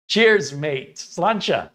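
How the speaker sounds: loud, high-pitched amateur Irish toast; attempted Irish accent. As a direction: try a Northern Irish toast with an American accent showing. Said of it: "trying to say it in their natural tongue".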